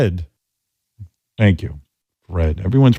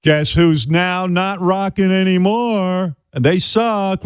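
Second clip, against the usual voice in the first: sneering anchor, higher and slow; mock headline voice; 8 semitones higher, much slower than usual. Mocking the news about the retired band, as a smug anchor. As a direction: announce it like a smug news tease.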